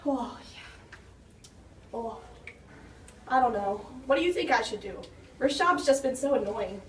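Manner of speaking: normal talking voice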